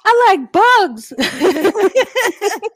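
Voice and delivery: put-on very high, loud, slow kid voice; childlike cartoon voice. Imitating kids' show character.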